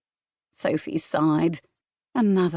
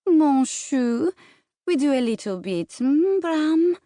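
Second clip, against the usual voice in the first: put-on French accent, much higher, sweetly wheedling; coaxing French accent; 9 semitones higher, slower than usual. Celeste wheedling Bram to stay, high sweet French accent. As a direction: coax him in a high, sugary French accent. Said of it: "the teasing French accent".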